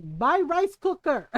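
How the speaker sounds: slow, emphatic mock order; blunt mom. Mom answering an excuse, blunt. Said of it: "And my mom was like".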